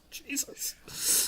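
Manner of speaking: whispered. Retold or imitated. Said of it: "I was like".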